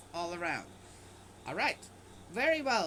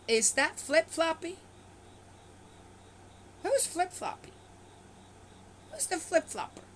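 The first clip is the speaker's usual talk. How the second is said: indignant disbelief; 5 semitones higher than usual. Real, not acted. Indignant rhetorical questions about God.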